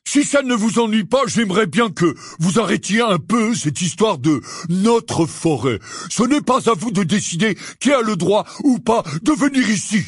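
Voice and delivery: high-pitched, forceful tirade; angry Hagrid. A narrator's reading. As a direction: tell them off with rising indignation. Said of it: "dit Hagrid avec colère".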